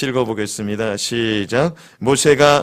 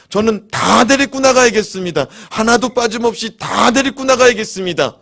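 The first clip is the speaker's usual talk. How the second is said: loud, high insistent declaration; defiant Moses; 8 semitones higher, 4 dB louder than usual. Retold or imitated. Moses defying Pharaoh, shouting his refusal. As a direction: plant your feet and refuse Pharaoh. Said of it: "그랬더니 모세가".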